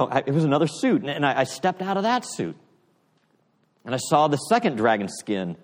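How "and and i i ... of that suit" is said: ordinary talk, nothing marked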